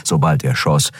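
ordinary delivery, nothing marked

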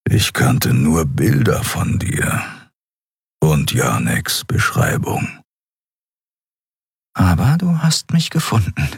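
a taciturn fighter speaking sparingly; gruff Keru. A narrator's reading.

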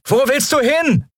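very high, strained yell held in volume; urgent high-pitched call; calling after someone. A narrator's reading. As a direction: shout it after a runner over the din. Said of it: "als sie ihr nachrief".

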